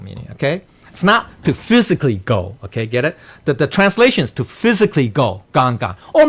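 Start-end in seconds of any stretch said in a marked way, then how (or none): none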